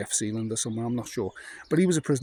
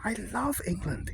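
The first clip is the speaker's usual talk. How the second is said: put-on high, happy old-man voice; old German man; 9 semitones higher, 4 dB quieter than usual. Old man telling the English lads of captivity, high happy voice. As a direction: voice the old prisoner with joy, pitched up.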